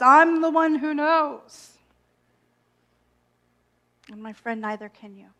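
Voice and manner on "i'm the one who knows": louder, slow know-it-all voice; mock arrogance. Voicing her own wounded ego, slow and arrogant. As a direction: puff up like a bloated ego. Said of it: "that says".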